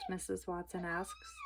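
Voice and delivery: ordinary delivery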